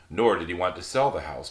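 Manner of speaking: usual speaking voice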